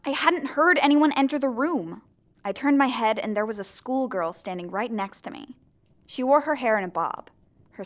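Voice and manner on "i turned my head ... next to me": ordinary talk, nothing marked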